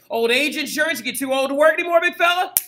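high, loud, rising hard sell; mock sales pitch. Teacher pitching insurance to an old worker, loud mock sales pitch. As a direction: pitch it loudly, climbing to the end.

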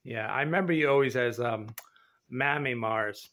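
usual speaking voice

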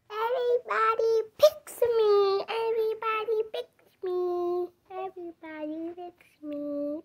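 high boasting singsong; high character voice. Pretend. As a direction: brag in a high toy voice.

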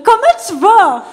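loud, high-pitched, exaggerated Quebec French; accent québécois appuyé. Shown as a demonstration. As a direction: lay on your thickest Quebec accent. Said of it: "sortir mon plus bel accent québécois". (gros accent québécois, fort et exagéré)

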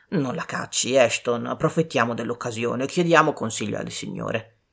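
a hint of a deep-voiced officer holding someone back; colonel. A narrator's reading.

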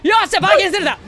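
high loud glee; excited. Real, not acted. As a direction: crow with delight. Gleeful at open area.

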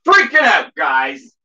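high panicked shout; freaking out. Real, not acted. Streamer with no Diet Coke shouting in panic to viewers. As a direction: yelp it out in real panic. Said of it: "I'm freaking out".

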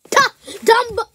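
high, loud title call; shouting out the next movie; excited shout. Real, not acted. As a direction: yell the title with delight.

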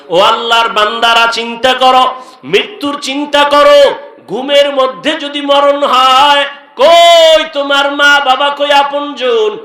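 high, pleading sermon cry; ringing preacher's appeal, stretched at the end; urgent exhortation. Real, not acted. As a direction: call out high, urging the congregation. Urges congregation to think of death.

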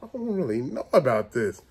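low, hushed, doubtful mutter; talking to himself. Retold or imitated. Uneasy man doubting the place to himself, low mutter. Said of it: "when you say to yourself".

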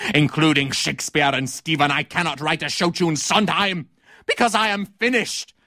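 indignant tirade rising to a high finish; theatrical denunciation. Acted. Critic renouncing theatre to actor Teddy Cotton, outraged. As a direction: accuse the actor, building to a melodramatic climax.

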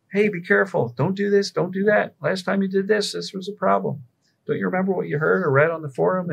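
high, urgent, alarmed warning voice; anxious brain warning. Retold or imitated. Anxious brain warning you off the forum, high and alarmed. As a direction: sound like a nervous inner alarm. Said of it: "A scared brain, perceiving danger".

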